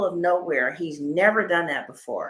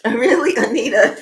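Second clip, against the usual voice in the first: loud, laughing disbelief; 4 semitones higher, 10 dB louder than usual. Real, not acted. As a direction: laugh it out at Anita. Laughing at a comment.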